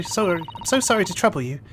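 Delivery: ordinary delivery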